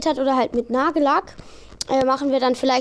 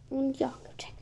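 whisper; 11 dB quieter than usual. Real, not acted. Quiet aside to camera.

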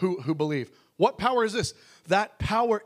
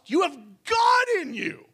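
loud, higher shout of joy; shouted proclamation; 8 semitones higher, 4 dB louder than usual. Real, not acted. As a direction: shout it out to the congregation. Preacher's climactic proclamation.

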